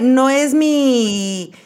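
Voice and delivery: ordinary delivery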